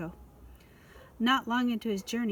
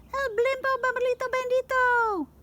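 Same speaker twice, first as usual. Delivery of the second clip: high dramatic voice. A narrator's reading.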